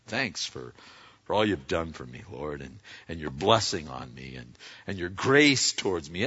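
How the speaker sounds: soft, sincere thank-you to God; grateful prayer. Acted. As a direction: murmur thanks with gratitude. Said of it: "That's grace giving".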